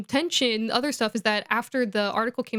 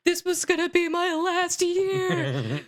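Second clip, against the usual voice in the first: whiny Oliver Wood; 8 semitones higher, slower than usual. Retold or imitated. Oliver Wood lamenting his final year.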